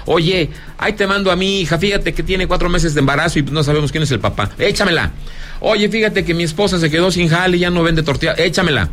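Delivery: lightly hammed-up favor askers; favor-seeking party cronies. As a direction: imitate people casually asking to slip someone in. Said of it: "eran recomendados del pri".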